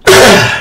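a short, loud, high cough; sharp cough. Real, not acted. Clearing her throat before excusing herself, a sharp cough. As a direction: cough sharply, then recover.

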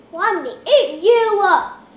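loud, high monster roar; loud troll threat. Acted.

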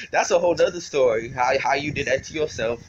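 ordinary talk, nothing marked

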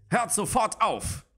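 stern call. A narrator's reading. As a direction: command them to freeze at once. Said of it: "Blausterns strenger Ruf".

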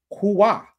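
"hua" drawn out as hoo-WAH; pronunciation demo. Shown as a demonstration. Streamer reads Dark Gift's correction, slow "hua". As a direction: say "hua" slowly, weight on the end. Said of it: "It is pronounced".

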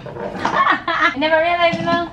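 laughing at herself. Real, not acted. Laughing at own mistake.

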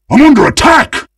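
loud, high cry of alarm; urgent high-pitched call; alarmed shout. Acted. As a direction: yell it out to warn the team. Game character calls for help.

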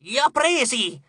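high triumphant cry; excited high squeal; shriek. A narrator's reading. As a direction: screech it like a winged demon. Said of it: "strillò una delle Arai".

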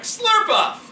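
"Slurpuff!" shouted high and loud, every sound crisp; over-enunciated. Shown as a demonstration. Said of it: "if you were like".

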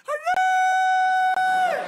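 high, loud hooray screech; mock fox scream. Imitating the fox screaming in the garden at night, shrill yell.

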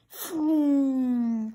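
ordinary delivery, nothing marked

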